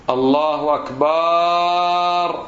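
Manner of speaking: الله أكبر ending on a long held "akbaaar"; wrong stretched akbar. Shown as a demonstration. Teacher mimicking some people's mistake, drawn-out akbaar. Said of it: "بعض الناس ايش يقول؟".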